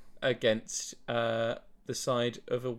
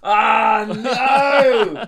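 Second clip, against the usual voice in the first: high, loud cry of defeat; anguished cry; 7 semitones higher, 9 dB louder than usual. Real, not acted. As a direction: cry out in dismay. Loses the question.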